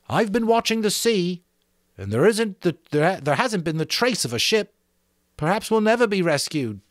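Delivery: a boy voiced high, brooding over no ship; gloomy Roger. A narrator's reading. Roger to the boys, gloomy about rescue. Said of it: "looked around at them gloomily".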